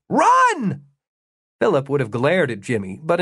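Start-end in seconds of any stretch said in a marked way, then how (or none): 0.1-0.8 high, loud warning yell; urgent shout. A narrator's reading. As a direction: shout it to someone being chased, voice shooting up. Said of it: "Jimmy shouted".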